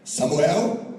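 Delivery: loud call, lifting at the end. Acted. God calling Samuel the third time, loud questioning call.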